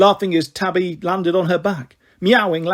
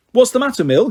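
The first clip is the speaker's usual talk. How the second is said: higher, louder call across the yard; yelling; 6 semitones higher, 4 dB louder than usual. A narrator's reading. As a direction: yell it out after her. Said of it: "yelled Enid".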